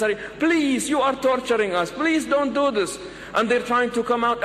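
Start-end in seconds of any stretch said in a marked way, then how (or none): 0.4-3.0 high-pitched pleading mock yell; storyteller acting out anxious shouting. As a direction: beg them urgently to give up. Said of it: "the friends start yelling".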